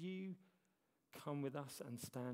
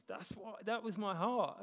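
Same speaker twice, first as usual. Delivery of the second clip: flustered excuse; 3 semitones higher, 7 dB louder than usual. Retold or imitated. Pastor squirming before God, loud hammed-up pleading. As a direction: talk your way out of it, comically. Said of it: "I was like".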